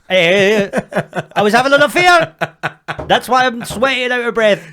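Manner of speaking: loud, high, laughing fake confession; mock guilty confession. Caught by girlfriend, joking loud laughing confession. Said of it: "I'm like".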